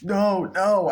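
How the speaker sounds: high-pitched mock cry of refusal; distressed protest. Woman about to be taken out by cops, mock-distressed protest. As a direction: imitate someone panicking as they protest.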